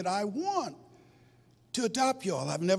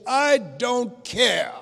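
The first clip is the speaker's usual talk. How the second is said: defiant; 6 semitones higher, 8 dB louder, much slower than usual. Retold or imitated. Defying brother's objection.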